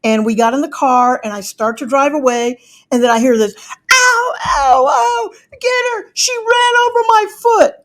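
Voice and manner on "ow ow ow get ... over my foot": high, squealing fake ouch, cried out; hammed-up yelp of a faker; mock pain yell. Ex-husband faking a crushed foot to the police, mock howl.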